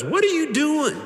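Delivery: high-pitched mimic of someone catching you idle; Kathy. Husband quoting Kathy catching him on his phone, high-pitched imitation. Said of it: "then Kathy comes up and".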